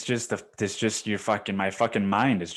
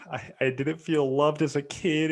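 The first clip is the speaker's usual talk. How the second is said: higher, mildly tearful client voice; mock tearful confession; 5 semitones higher than usual. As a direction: act out crying to a therapist. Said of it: "you cry harder".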